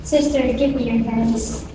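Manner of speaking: softly, almost under the breath; a quiet frightened request; hushed plea. Acted. Girl asks her sister for her hands, hushed and frightened.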